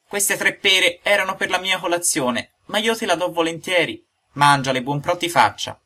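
a hint of a kind old carpenter; kindly old father. A narrator's reading. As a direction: give it up fondly. Geppetto offering pears.

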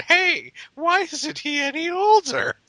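very high, slow, puzzled voice; mocking senile old person. Friend mocking the senile churchgoers, very high. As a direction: play a confused old person.

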